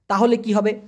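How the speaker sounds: ordinary delivery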